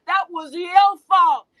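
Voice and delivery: slow, loud shout that lands the blame; preaching shout. Real, not acted. To the women listening, a slow loud preaching shout. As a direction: hammer it out slowly, full voice. Preaching rant at the womb bearers.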